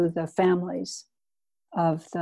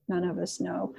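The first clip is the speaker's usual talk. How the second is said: softer, reflective repeat; quiet echo; 7 dB quieter than usual. Shown as a demonstration. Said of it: "echoed quietly".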